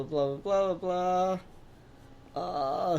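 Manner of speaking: usual speaking voice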